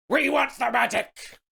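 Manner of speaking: a squeaky hammed-up goblin roar; goblins' mock roar. A narrator's reading. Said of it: "roared the goblins".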